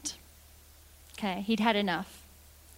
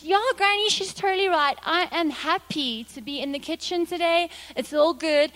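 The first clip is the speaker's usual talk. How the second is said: forced eager agreement; 5 semitones higher, 4 dB louder than usual. Acted. Cornered boy hastily agrees.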